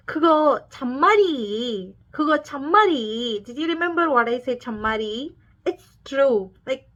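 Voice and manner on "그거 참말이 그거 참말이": Cholla ajumma. Retold or imitated. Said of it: "or else".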